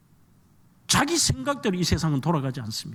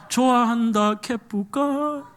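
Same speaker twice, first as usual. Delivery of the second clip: slow sung 좋아한다 개뿌까 in Gyeongsang dialect; 4 semitones higher, much slower than usual. Pretend. Pastor redoing the love song lyric in Gyeongsang dialect, slow. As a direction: sing it like a blunt Gyeongsang local. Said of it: "경상도 말로 하면".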